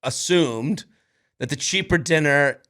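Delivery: usual speaking voice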